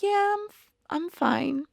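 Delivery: high, soft "I'm fine"; calm reassurance. Retold or imitated. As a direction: brush off a friend's worry in a high, light voice. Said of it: "she's heard me so calm".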